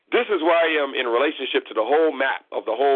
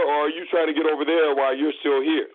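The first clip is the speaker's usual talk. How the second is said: ordinary talk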